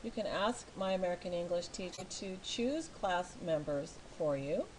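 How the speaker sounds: ordinary talk, nothing marked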